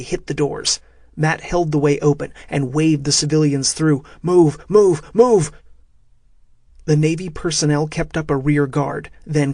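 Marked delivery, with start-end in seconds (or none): 4.2-5.5 louder with each move; rising shouted order; urgent shouting. A narrator's reading. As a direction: hurry them through the doors. Holding doors, waving civilians through.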